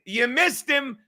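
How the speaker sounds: high, very loud taunting shout; screaming. Retold or imitated. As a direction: scream it out in triumphant defiance. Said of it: "And I started screaming".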